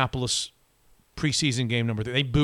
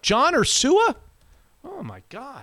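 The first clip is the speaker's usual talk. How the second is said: excited; 9 semitones higher, slower than usual. Real, not acted. Delighted by shared birthplace.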